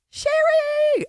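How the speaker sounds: calls her name out high and loud, delighted; a big happy squeal of welcome; excited greeting. Real, not acted. Host greeting a generous donor, excited.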